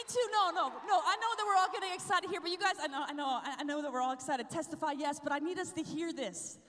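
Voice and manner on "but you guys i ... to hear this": ordinary delivery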